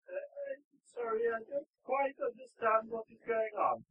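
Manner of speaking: high, soft, hesitant priest; meek, confused high voice; bewildered priest. Acted. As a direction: ask timidly, quite lost.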